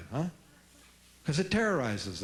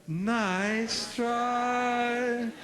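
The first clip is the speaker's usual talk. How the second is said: higher, grinning nice try; with a big grin; 7 semitones higher than usual. Shown as a demonstration. Parent answering a kid's manipulation, grinning. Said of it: "big grin, let's do it".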